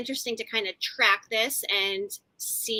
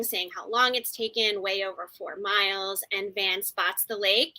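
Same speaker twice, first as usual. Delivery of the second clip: ordinary talk, nothing marked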